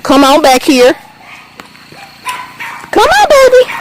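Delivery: very high, sweet coaxing call; calling the kittens. Real, not acted. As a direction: lure the kittens back in a high voice. Calling kittens following her.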